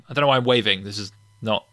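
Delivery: ordinary talk, nothing marked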